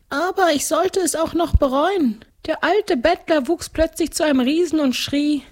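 very high ghost-count voice; gluttonous ghost count. Acted. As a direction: recount it as a high-pitched ghost nobleman. (hohe Stimme eines Gespenstergrafen)

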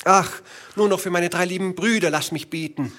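high, hurried plea; pleading wife. Acted. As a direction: beg in a high, anxious voice.

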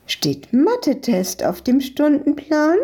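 high, teasing call; calling across. A narrator's reading. Ice-cream shop owner calls to the truant girl, teasing. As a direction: call a question over to them. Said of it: "rief die Besitzerin von der Eisdiele ihnen zu".

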